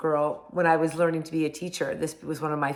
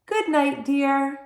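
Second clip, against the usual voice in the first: sleepy wife; 8 semitones higher, 3 dB louder, slower than usual. Acted. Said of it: "his wife, who's already sleeping, says".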